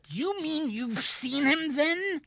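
high, slow, halting question; hardly daring to believe it; shaken disbelief. A narrator's reading. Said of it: "Wilson was too shaken".

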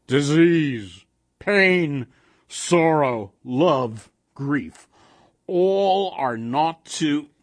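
loud, measured golem; monster's solemn pronouncement; harsh and monotonous. A narrator's reading. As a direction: toll each word separately. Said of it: "His voice was harsh and monotonous".

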